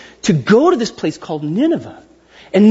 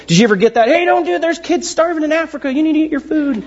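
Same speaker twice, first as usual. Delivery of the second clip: lightly mimicked scolding mom, higher; a hint of a nagging mom, pitched up; parent scolding; 6 semitones higher, faster than usual. Mom scolding a kid who won't eat, lightly mimicked.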